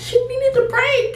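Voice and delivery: high, crying voice breaking on break; mock grief, voice cracking high; crying through it. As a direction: repeat it as if sobbing over a dead pet. Eulogy line repeated while crying.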